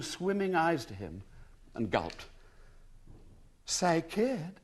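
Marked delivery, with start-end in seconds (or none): 3.6-4.6 lightly voiced American twang on kid; a hint of American drawl, kid falling. A narrator's reading. Young American woman calling to William, light American twang. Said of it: "with an American twang".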